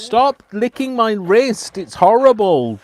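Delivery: high, drawn-out shout of disgust; exasperated high scolding; loud protest. Real, not acted. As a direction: shout them off, stretching the words. Scolding someone licking the wrist.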